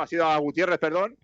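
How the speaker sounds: ordinary talk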